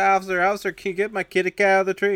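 high, loud imitation old lady; old lady pleading. Old lady begging a policeman to get her cat, pleading. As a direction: call out for help as an old lady. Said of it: "this old lady's like".

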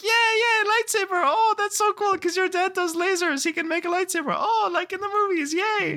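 put-on very high, squealing kid voices; hammed-up falsetto child excitement; cheering kids. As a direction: imitate a gaggle of thrilled schoolkids. Kid's friends cheering him on.

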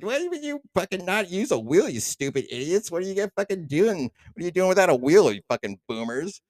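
high, mocking sneer; kid sneer. Cave kids mocking their parents, high sneer. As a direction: scoff at the clueless parents. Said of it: "the kids are like".

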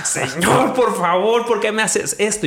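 high, pleading retold complaint; angry plea to God. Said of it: "me enojé mucho con Dios y le dije".

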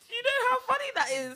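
very high laughing voice; squealing excitedly. Real, not acted. Laughing hard.

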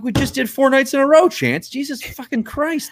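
high-pitched exasperated protest, drawing out the curse; mock exasperation. Tired mentor replying to an over-keen student, high mock exasperation.

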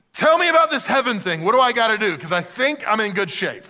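eager burst, high and loud at first; excited young man. Acted. Young man asking Jesus about heaven, excited. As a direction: launch in eagerly, easing toward the end. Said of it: "hear the excitement in this young man's voice".

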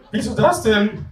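high questioning character voice; high-voiced flatmate. Flatmate questioning the refusal of yoga, high voice. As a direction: ask it from high up.

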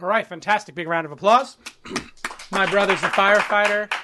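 usual speaking voice

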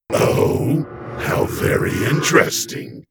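breathy, intrigued demon murmur; musing demon. A narrator's reading. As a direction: muse with dark interest. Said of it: "Geldenok mused".

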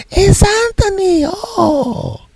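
very high, drawn-out mock squeal; delighted greeting. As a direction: imitate her thrilled shout, stretching the name. Lady greets a favourite customer.

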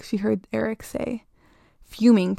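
ordinary delivery

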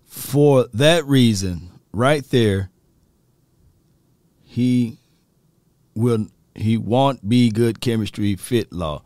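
slow, deliberate read with long pauses; halting, careful slow reading; reading slowly. Real, not acted. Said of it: "let me read this slowly".

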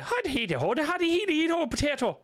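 high, sing-song stage Irish; diddly-eye Irish accent. Acted. Mocking the bad Irish accent misconception, diddly-eye Irish. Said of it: "Diddly eye accents is probably a bad one".

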